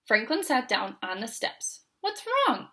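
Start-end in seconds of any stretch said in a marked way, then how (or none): 2.0-2.7 squeaky high little question; a tiny creature asking, pitched way up; high small snail voice. A narrator's reading. Snail asking Franklin what's wrong, high and small. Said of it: "asked Snail".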